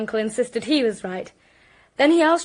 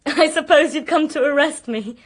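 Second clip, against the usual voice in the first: laughing through the words, a little louder; nervous laugh; 3 semitones higher, 6 dB louder than usual. Acted. Woman laughing nervously as police arrive, laughing. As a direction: brittle laugh while she speaks.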